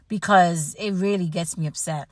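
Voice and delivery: normal talking voice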